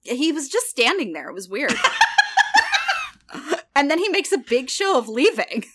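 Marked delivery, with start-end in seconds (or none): 1.8-3.1 very high squeal of laughter. Real, not acted. Laughing at weird scene.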